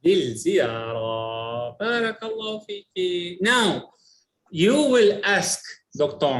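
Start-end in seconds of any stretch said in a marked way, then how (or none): 0.0-1.7 للزيارة with lil clearly joined; modelled pronunciation. Shown as a demonstration. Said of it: "آه، للزيارة".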